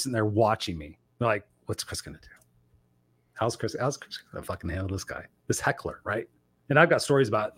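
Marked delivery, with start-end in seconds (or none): 1.7-6.0 low, very soft murmur; hushed wondering. Retold or imitated. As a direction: imitate onlookers murmuring under their breath. Staff watching, wondering among themselves.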